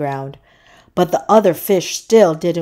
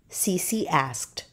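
plain reading voice

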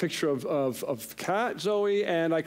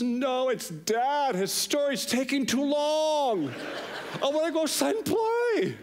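put-on high, drawn-out childish wail, building higher; whining little boy; 11 semitones higher than usual. Young son bored by dad's long story, mock wailing complaint. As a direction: imitate a kid's exasperated whine. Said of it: "Benjamin says".

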